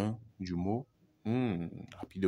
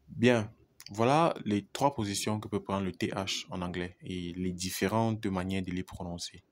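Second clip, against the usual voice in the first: normal talking voice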